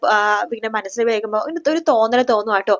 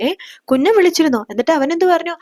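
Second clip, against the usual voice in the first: eager, higher and louder surprised question; 6 semitones higher, 4 dB louder, faster than usual. Acted. Relative learning Ashwin phoned, asks in eager surprise. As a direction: jump up in pitch, startled and eager.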